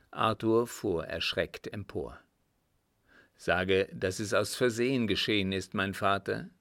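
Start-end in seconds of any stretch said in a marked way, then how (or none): none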